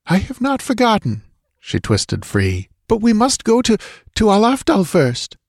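high, hurried warning; urgent woman voice. A narrator's reading. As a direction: hurry him along, tense. Voices Zabibi.

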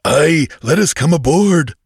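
high, loud pirate plea; clamor to be let on board. A narrator's reading. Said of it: "clamored a lean Shemite".

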